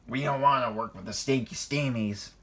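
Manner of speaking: put-on voice for an oily diesel; oily, contemptuous villain voice; oily sneer. A narrator's reading. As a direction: sneer it slickly, with distaste. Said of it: "oiled Diesel".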